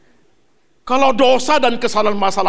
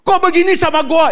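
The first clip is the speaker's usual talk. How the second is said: mimicking a complaint; 7 semitones higher, 3 dB louder than usual. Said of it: "Itu kalimat you".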